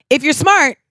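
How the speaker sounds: a lightly done Obama speech cadence; Barack Obama. Acted. Host answering the shop scene, a light Obama impression. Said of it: "I started talking like Barack Obama".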